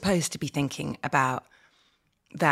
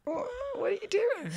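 put-on confused old man; 7 dB quieter than usual. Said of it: "It's like".

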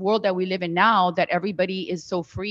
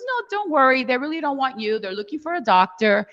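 higher-pitched, dismissive don't-worry; imitated reassurance; 6 semitones higher than usual. Friend coaxing her along to a casting, imitated reassurance.

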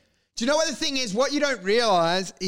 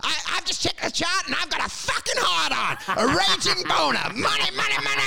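high-pitched manic salesman; crazy caller; 10 semitones higher than usual. Acted. Crazy broker ringing a client, manic excitement. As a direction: rave down the phone, building to a chant. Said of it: "some crazy person to ring them on the phone".

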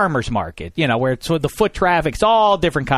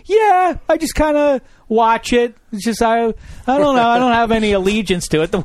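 mock indifferent fan; 8 semitones higher than usual. Mocking non-fan football viewers.

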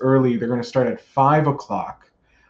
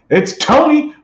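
surprised; 14 semitones higher, 5 dB louder than usual. Real, not acted. Spots a guest in chat.

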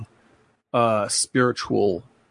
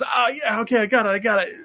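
Brad Pitt; 10 semitones higher than usual. Retold or imitated. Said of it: "he goes".

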